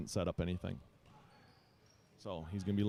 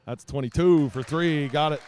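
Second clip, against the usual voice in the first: loud, high, stretched-out three-point call; big live scoring call; excited play-by-play; 5 semitones higher, 11 dB louder, slower than usual. Real, not acted. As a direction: call the shot as it drops. Calling a made three-pointer.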